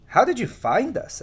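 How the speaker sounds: put-on a curious child asking; Jane's voice. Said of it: "asked Jane".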